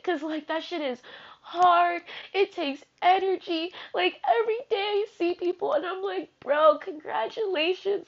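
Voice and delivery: high, tearful encouragement; crying while talking. Real, not acted. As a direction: cheer them on through real tears. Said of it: "seriously crying now".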